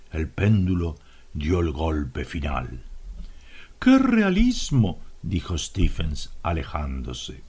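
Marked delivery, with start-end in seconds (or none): 3.8-5.0 delighted. A narrator's reading. Stendhal admires his own death.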